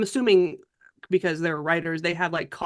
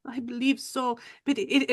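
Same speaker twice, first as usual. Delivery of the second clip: lightly voiced, higher French lady; confiding Frenchwoman; 4 semitones higher, 4 dB quieter than usual. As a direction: confide hesitantly with a French lilt. French character confides about murders.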